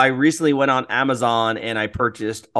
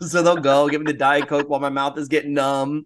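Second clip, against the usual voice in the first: ordinary delivery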